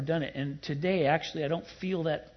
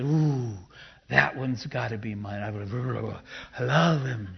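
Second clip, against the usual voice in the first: hammed-up "ooh", then a lower, doting voice; mock smitten God; 4 semitones lower, slower than usual. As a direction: play at falling for someone, lightly hammed up. Jokingly acts God choosing someone.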